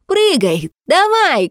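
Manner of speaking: high, loud, goading yell; shouting. Acted. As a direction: push her to jump at full voice. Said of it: "кричала она".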